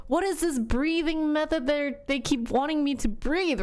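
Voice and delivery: high, confused complaint; puzzled by the breathing orders; bewildered. Retold or imitated. Mom retelling confusion at the nurses, high and puzzled. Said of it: "I'm like".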